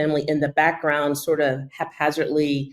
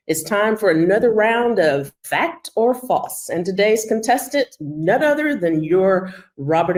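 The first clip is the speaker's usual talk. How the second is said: game show host; 3 semitones higher than usual. Pretend.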